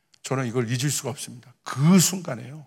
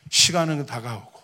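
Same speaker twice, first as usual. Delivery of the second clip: ordinary talk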